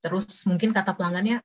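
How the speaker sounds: usual speaking voice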